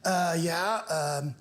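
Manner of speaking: slow, hesitant millennial with rising line ends; millennial house hunter. Acted. Millennial husband introducing himself on a house show, slow uptalk. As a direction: drag it out with uptalk, like a clueless young buyer. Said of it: "you'll see a millennial couple".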